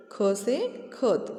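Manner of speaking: very slow classroom spelling; slow, syllable-by-syllable. Shown as a demonstration. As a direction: draw out each sound for children. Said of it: "teacher spells out the word slowly".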